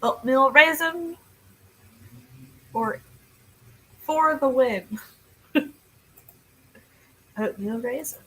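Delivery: normal talking voice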